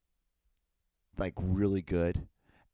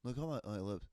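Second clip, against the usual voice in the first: normal talking voice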